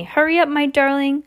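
high, loud urgent call; grandmother shouting. A narrator's reading. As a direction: grandmother calling out, pitched well up. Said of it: "it shouted".

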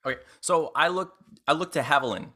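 usual speaking voice

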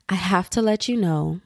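ordinary delivery

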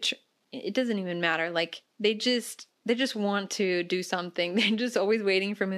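ordinary talk, nothing marked

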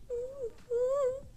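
high, thin mock whimper; holding back tears. Friend asked if okay, whimpering back tears. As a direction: squeeze it out through pretend tears. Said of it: "been holding back the tears".

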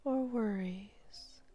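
slow hypnotherapist's guiding voice, long pauses; sleep hypnosis. Real, not acted. Hypnotherapist guiding a listener to sleep, slow and soothing.

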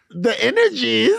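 loud, high, drawn-out disbelief; incredulous. Retold or imitated. Retelling own reaction to the hookup's excuse, loud incredulous echo. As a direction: throw it back as a stunned, high question. Mocking his answer in disbelief.

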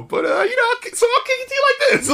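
high falsetto mock rapper line; mimicking the rapper. Reviewer imitating the rapper's pickup line. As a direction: ham up the smooth rapper in falsetto. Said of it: "it's just the way he does it".

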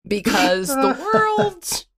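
slow, solemn poem reading; slow poem recital. Pretend. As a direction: recite it slowly like a grand poem. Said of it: "Take us away".

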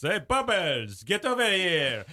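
slow, hammed-up comedian summons; Rodney Dangerfield. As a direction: call someone over, dragged out and rising in pitch. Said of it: "I do a great impression of Rodney".

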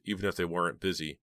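usual speaking voice

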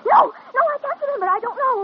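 loud, distressed denial; panicky. Acted. Girl to the doctor, panicky denial. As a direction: push the memory away in panic.

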